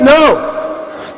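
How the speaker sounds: ordinary delivery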